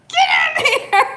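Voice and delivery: high loud retold shout, laughing; mock yell. Husband calling the dogs inside, high loud laughing shout. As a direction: bark the order at the dogs, laughing. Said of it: "My husband was so mad".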